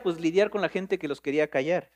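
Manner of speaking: ordinary talk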